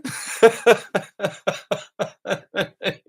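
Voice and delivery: high, loud burst of laughter; helpless laughter. Real, not acted. Cracks up telling yawning story.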